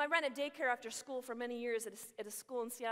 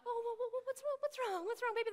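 put-on high-pitched fussing over a crying child; imitated baby-talk comforting; cooing concern; 11 semitones higher than usual. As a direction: coo anxiously, way up high, as if to a baby.

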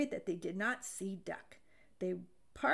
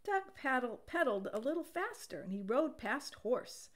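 usual speaking voice

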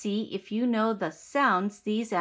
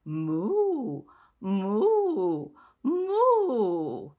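sung cow moos; much slower than usual. Shown as a demonstration. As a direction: moo along in the song like a cow. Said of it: "This is how the cow sounds".

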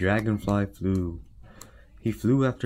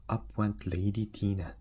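ordinary delivery, nothing marked

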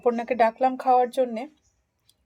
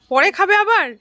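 calling out loud; 11 semitones higher, 13 dB louder than usual. Real, not acted. Calling to Aparna far away.

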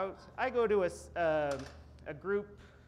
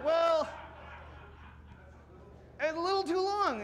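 mock shout; 9 semitones higher than usual. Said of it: "And I was like".

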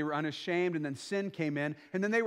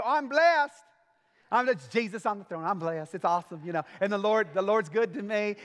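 mock churchy answer; 2 semitones higher than usual. Churchgoer answers a greeting with mock bright piety. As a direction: play the cheerful fake-fine church member. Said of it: "they go".

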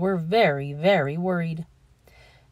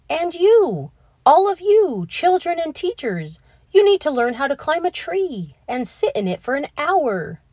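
high, louder, ringing; grand announcement; 9 semitones higher, 4 dB louder than usual. A narrator's reading. Principal announcing to the whole school, grand and excited. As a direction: proclaim it to everyone. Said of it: "Mister Keene said".

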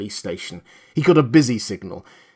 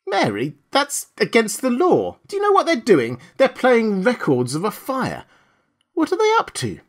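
high-pitched astonishment, voice leaping upward; amazed; 10 semitones higher than usual. A narrator's reading. Husband to wife at a truck playing fire records, amazed. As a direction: exclaim in disbelief. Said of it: "Burkhart said in amazement".

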